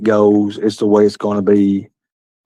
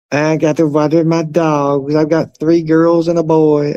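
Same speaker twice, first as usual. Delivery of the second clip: slow, high put-on voice; mocking imitation; 6 semitones higher, slower than usual. Mocking AVN talking about his dogs, slow high put-on voice. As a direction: stretch the words out high, making fun of him. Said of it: "he talks like this".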